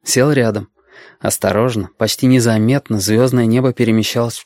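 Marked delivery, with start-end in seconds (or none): none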